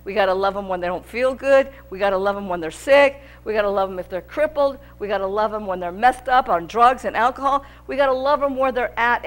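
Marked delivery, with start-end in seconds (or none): none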